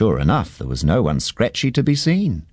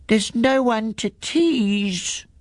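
much higher, slow little goat voice, a touch sad; a small kid goat moping slowly; high slow little goat, mildly sad; 13 semitones higher, slower than usual. Acted. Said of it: "said the little goat sadly".

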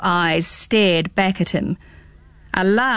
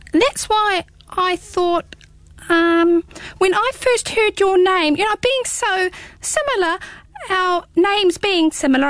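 very high hedgehog, hesitant and bashful; shy hedgehog voice; 10 semitones higher than usual. Acted. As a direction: stumble shyly toward asking a favour. Hedgehog shyly proposing friendship.

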